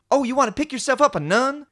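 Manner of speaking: high, loud mocking complaint; Raffi. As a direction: nag with incredulous questioning. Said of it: "Will Raffi once again complain about everything".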